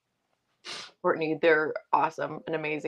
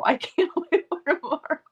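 higher, broken by laughter; laughing repeat; 11 semitones higher than usual. Retold or imitated. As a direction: let the laugh break the line.

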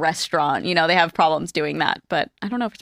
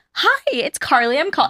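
higher, slower, too-polite phone greeting; 8 semitones higher than usual. Retold or imitated. Carly imitating her own phone greeting, higher and slower. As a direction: pitch up like you're nervous on the phone. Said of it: "why did I go so high pitch".

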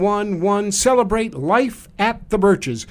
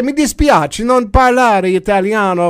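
loud, apologetic pidgin Italian; bad Italian accent; 2 semitones higher, 5 dB louder than usual. Tourist to the pasta lady, bad Italian accent. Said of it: "in my bad Italian accent".

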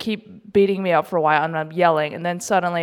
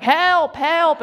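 higher calls for help, not full volume; mock cry for help; 9 semitones higher than usual. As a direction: call out for help half in jest. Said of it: "and I just yelling".

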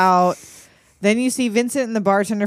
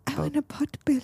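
high whispered character voice; mock whisper; 7 semitones higher, 7 dB quieter, faster than usual. Host mimics girlfriend wanting pot belly, high whisper. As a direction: whisper it as a soft imitation.

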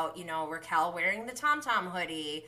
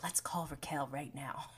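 hushed mock scheming; imitated conspiratorial whisper; 6 semitones lower, 10 dB quieter than usual. Imitating James plotting a phone call, conspiratorial whisper. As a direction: whisper it like a secret plan.

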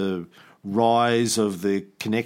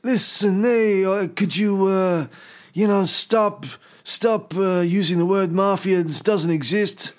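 higher, wheedling mobster voice; mafia guy; 6 semitones higher than usual. Retold or imitated. Imitating an Italian mobster objecting to the word mafia, higher. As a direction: play a smooth mob guy asking politely. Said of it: "the Italian mafia would be like".

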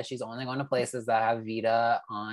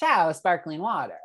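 mock Italian; hammed-up faux Italian; Italian accent; 4 semitones higher than usual. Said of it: "[name] loves to be faux Italian".

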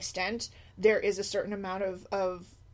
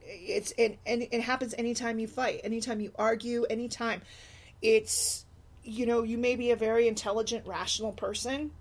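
ordinary talk

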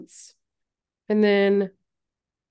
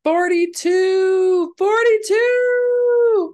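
rising, ever louder cheer; excited shout; 10 semitones higher, 7 dB louder, slower than usual. Real, not acted. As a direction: yell the number with glee. Countdown to Halloween, cheering.

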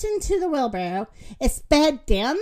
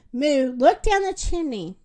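ordinary delivery, nothing marked

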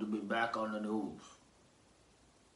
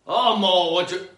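loud, higher call; 10 semitones higher, 15 dB louder than usual. Pretend. Host calling to sidekick Mo, loud. Calls out to absent Mo.

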